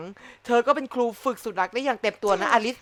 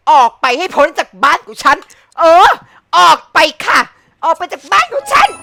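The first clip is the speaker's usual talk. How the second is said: from loud to much higher screaming; building angry yell; 5 semitones higher, 12 dB louder than usual. Real, not acted. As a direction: shout her out of the house. Chasing rival away.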